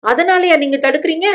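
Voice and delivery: high-pitched indignant question; indignant challenge. Acted. Wife confronting husband over blocking the marriage, indignant.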